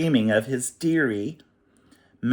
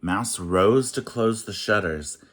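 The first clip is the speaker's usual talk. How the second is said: ordinary delivery, nothing marked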